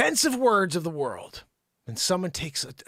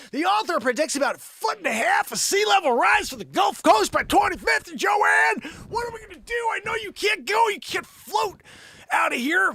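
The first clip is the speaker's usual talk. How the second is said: high, frantic comic yelling; screaming at her; 9 semitones higher, 3 dB louder than usual. Acted. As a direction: yell the report at her in alarm. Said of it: "So I scream at her".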